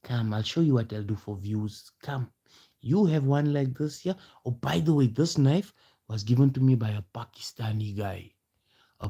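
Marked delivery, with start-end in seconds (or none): none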